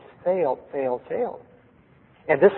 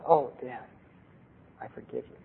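tearful forgiveness; 3 semitones lower, 12 dB quieter than usual. Retold or imitated. Daughter forgives, tears flowing.

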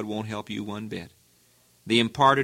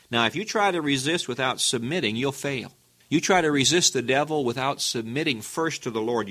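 usual speaking voice

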